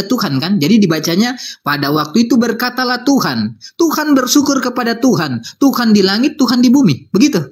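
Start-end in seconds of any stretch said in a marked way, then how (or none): none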